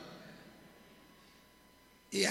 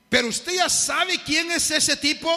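put-on a high, cocky street kid sneering; mocking street youth; 10 semitones higher, 5 dB louder than usual. Street youth mocking the preacher to the orange seller, cocky. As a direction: play the brash young man running down the preacher.

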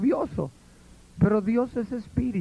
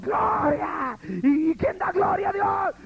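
high, shouted crowd call; hammed-up preacher whipping up the room; overdone church shout; mock hype shout; 10 semitones higher, slower than usual. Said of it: "ese dirigente".

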